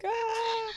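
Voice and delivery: high, held comic shriek; mock scream. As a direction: let out a long high mock scream of overwhelm. Retold reaction to wild baby.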